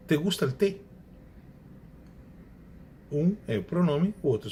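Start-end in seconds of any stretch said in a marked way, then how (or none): 0.1-0.8 Spanish te gusta el té read out as a teacher's example; lightly Spanish example. Shown as a demonstration. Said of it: "Por exemplo".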